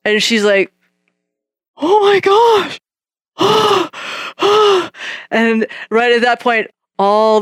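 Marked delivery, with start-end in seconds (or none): 1.8-2.8 put-on high, breathless "Oh my gosh" in a girl's voice; astonished girl; stunned gasp. Girl stunned by healing word. 3.4-5.2 sharp startled intake of breath, pitched up; shocked girl's gasp; high gasp. Retold or imitated. Girl's reaction.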